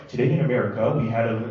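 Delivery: a hint of a news announcer; imitating radio guy. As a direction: mimic a droning radio host. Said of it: "has a guy go".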